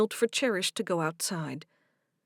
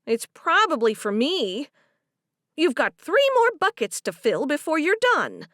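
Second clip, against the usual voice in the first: put-on stern older woman voice; 7 semitones higher, 8 dB louder than usual. Harriet's voice in story.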